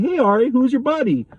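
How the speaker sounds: high, singsong, needling question; re-enacted confrontational hello; pointed mock-friendly greeting. Confronting ex in front of rival.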